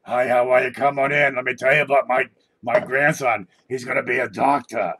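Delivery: loud, high granny impression welcoming a guest; old grandma voice. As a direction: crank it up like a loud old grandma greeting at the door. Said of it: "they sound like this".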